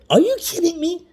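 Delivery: high, loud incredulous cry; shocked disbelief. Retold or imitated. Retelling to Lana, the supervisor's high loud disbelief. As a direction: mimic the supervisor's shock at the license. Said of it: "and verbatim she said".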